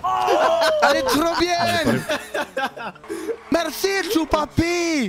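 high, elated fake Spanish; mock Spanish accent. Roleplayer thanking Choupapi for a gift, mock Spanish accent. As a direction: gush in a laughing pretend Spanish accent. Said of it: "mettre des sonorités espagnoles à la fin".

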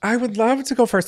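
high, bright eager reply. Real, not acted. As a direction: jump in keen to go. Eager to start.

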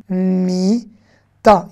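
"Mita" with a long drawn mi, then ta; syllable by syllable. Shown as a demonstration. Teacher rereading the Hebrew word for bed, syllable by syllable. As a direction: stretch the first syllable of "Mita", pause, finish. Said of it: "on va faire consonne plus voyelle".

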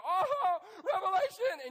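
high, loud, laughing mock panic; mock freak-out. Pastor mocking scared listeners, a loud laughing mock shriek. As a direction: shriek it like a spooked churchgoer, hammed-up. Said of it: "it freaks some of you out".

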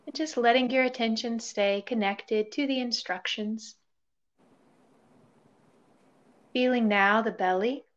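calm body-scan voice with long pauses; guided meditation. Real, not acted. Yoga teacher leading listeners through a body scan, guided meditation. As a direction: guide them gently into stillness, leaving space between phrases.

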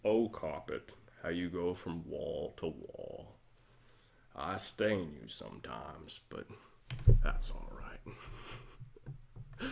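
slow, low, soft narrator drone; slow soft Morgan Freeman musing; Morgan Freeman impression. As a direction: savor every word like a documentary. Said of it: "like this carpet".

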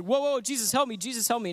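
high, loud mock panic cry for help; freaking out. Peter sinking, crying to Jesus in hammed-up panic. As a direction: play-act a sinking man panicking. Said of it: "And he starts freaking out".

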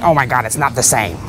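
mock American whine of protest. Vlogger imitating Americans who will object, mock American accent. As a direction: put on a light American accent. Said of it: "I know Americans will be saying".

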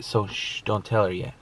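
hushed, secretive. Real, not acted. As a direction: keep it down, sharing a secret. Keeping the surprise secret from her.

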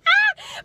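a sudden high fangirl squeal; short high-pitched excited shriek; excited fan shriek. Retold or imitated. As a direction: squeal like you just met your idol. Fans shriek at [name].

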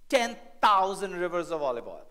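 sarcastic. Acted. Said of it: "a little bit of sarcasm here".

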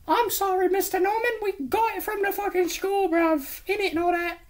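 squeaky, very high mock British voice; put-on British accent. Kid answering Mister Norman, squeaky mock British accent. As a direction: shoot up into a silly British falsetto. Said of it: "doing accents to change it".

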